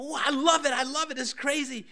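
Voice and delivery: high, loud, gushing over a present; mock gushing delight. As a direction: squeal it like someone thrilled by a gift.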